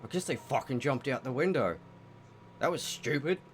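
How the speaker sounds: grumbling orc, mildly annoyed; lightly acted, disgruntled orc giving up; angry orc. Game master voices an orc abandoning the search, irritated. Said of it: "one more angry orc say".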